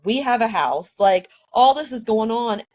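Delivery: ordinary talk, nothing marked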